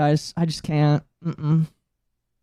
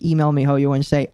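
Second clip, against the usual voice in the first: usual speaking voice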